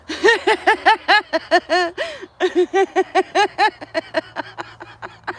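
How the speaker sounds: long wheezing giggles; can't stop giggling; real uncontrollable giggling; giggling fit. Real, not acted. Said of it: "giggling me head off".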